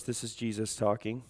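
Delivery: ordinary talk, nothing marked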